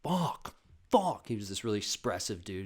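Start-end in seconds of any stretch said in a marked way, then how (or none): 0.0-1.3 panicked friend. Retold or imitated. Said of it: "he was just sitting there like".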